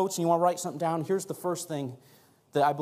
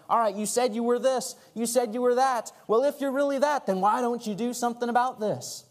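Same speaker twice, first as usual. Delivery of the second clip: higher-pitched acted taunt; poking fun, provoking; 7 semitones higher than usual. Voicing the mockers taunting Jesus on the cross, provoking. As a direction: goad him with a raised, challenging voice. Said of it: "poking fun at him".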